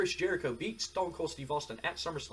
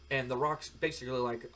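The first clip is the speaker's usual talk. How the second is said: usual speaking voice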